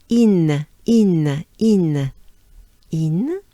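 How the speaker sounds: "In" as a long "een", each one separate; French I. Shown as a demonstration. Teacher drilling the first syllable, long I. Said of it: "let's go with I first".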